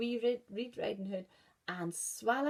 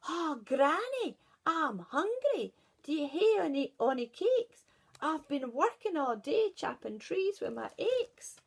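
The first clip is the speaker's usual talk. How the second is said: forester shouting; 8 semitones higher, 6 dB louder than usual. Acted. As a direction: bellow through the house to wake the wolf. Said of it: "shouted at the top of his voice".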